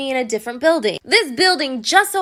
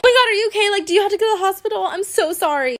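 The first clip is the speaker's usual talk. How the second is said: mock hysterical; 6 semitones higher, faster than usual.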